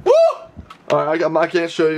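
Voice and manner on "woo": short high celebratory whoop; excited whoop. Real, not acted. Order has been placed, excited whoop. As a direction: whoop for the win.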